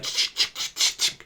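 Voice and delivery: whispered clicking shutter sounds; voiceless ch ch ch of cameras; camera shutter noises. Retold or imitated. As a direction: imitate paparazzi cameras snapping.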